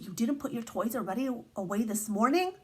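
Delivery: ordinary delivery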